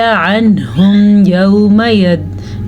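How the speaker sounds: very slow chanted recitation; Quran recitation. Shown as a demonstration. Teacher reciting the next verse for students, very slow chant. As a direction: stretch every syllable in a slow chant. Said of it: "तो भी रॉ पुर पड़ी जाएगी।".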